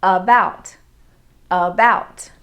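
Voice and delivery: ABOUT with a relaxed "uh" at the start; slow, loud model of "About", first vowel reduced to uh; schwa first vowel. Shown as a demonstration. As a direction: say "About" carefully, opening on a schwa. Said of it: "forms a schwa sound".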